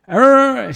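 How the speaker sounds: loud, high "Arr!" imitating an annoyed cashier; mock angry growl. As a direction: burst out like a fed-up cashier. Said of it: "She said it louder".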